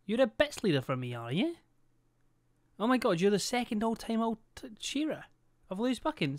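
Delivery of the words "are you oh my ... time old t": ordinary delivery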